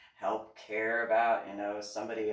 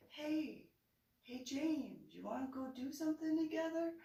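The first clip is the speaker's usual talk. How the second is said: a much higher, quickened bright invitation; sing-song coaxing mom; 14 semitones higher, 6 dB quieter than usual. Retold or imitated. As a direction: lure him in sweetly. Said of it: "I was like".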